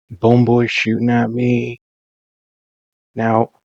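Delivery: ordinary delivery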